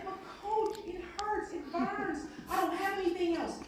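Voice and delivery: high, strained, pleading; exhausted player. Retold or imitated. Player begs coach mid-crawl, high and strained.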